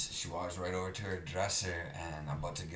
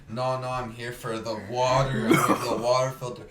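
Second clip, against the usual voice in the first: loud, high retold protest; flustered protest; 7 semitones higher, 13 dB louder than usual. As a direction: fend them off loudly, playing up the panic. Retelling alarmed refusal of advance.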